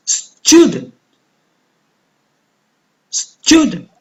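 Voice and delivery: Student with STU high and long; Student, STU-dent, teacher's exaggerated stress; stressed first syllable. Shown as a demonstration. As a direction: punch the first syllable of Student, let the end drop. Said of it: "going to pronounce louder and longer".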